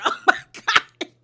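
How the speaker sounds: high, slow laughing oh my God; real laugh, amazed; laughing disbelief. Real, not acted. Recapper reacting to Bronwyn's line, laughing high. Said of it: "I said".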